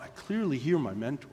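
usual speaking voice

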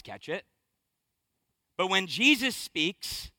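low, soft, deliberate preaching; measured pulpit delivery, held back; hushed preaching build-up. Real, not acted. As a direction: let each point land in long pauses. Preacher turns anecdote into slow sermon point.

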